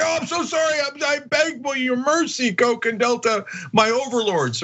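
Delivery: high-pitched, whiny mock pleading; mock grovelling apology. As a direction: beg in an exaggerated high voice, like a servant to masters. Mocking a senator's grovelling apology.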